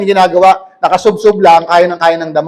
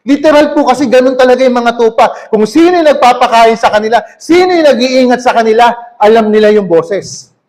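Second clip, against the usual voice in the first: ordinary talk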